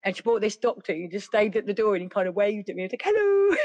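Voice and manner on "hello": mock greeting. Mock greeting to waving doctor.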